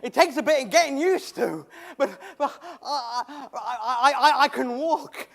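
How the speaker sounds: a man who can't believe he's walking, laughing; laughing in wonder. Acted. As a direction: laugh through each halting word as you test your legs. Stumbling through his first steps.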